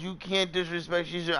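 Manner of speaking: lightly voiced scolding father. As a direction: imitate dad scolding. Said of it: "He goes".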